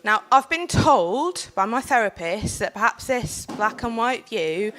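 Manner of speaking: earnest public speech to a panel; formal speech. Acted. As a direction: speak it like a prepared talk. Delivers prepared panel speech.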